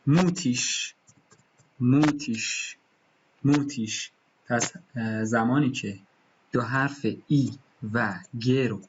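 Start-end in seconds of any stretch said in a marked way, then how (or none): 0.1-4.1 موتیش, final ig breathed out as ish; German pronunciation. Shown as a demonstration. Teacher models a German word for the class.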